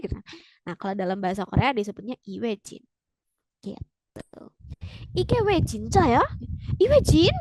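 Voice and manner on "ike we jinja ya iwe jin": high, astonished questioning; acted shock, pitch jumping up; disbelief. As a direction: ask as if you can't believe your ears. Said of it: "Kayak nggak nyangka gitu ya".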